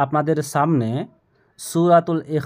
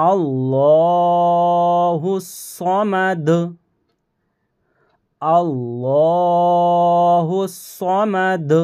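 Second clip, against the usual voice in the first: slow chanted recitation, higher and louder; Quran recitation; 3 semitones higher, 4 dB louder, much slower than usual. Shown as a demonstration. Teacher recites for students to copy, slow chant. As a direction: recite slowly with long vowels. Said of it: "এখানেও ল পড়তে হবে".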